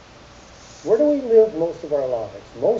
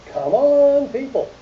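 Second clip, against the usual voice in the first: louder and higher, rallying the room; urging the crowd; 9 semitones higher, 5 dB louder, slower than usual. Real, not acted. Speaker urging the audience to admit it, louder. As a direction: come on, people, rouse them.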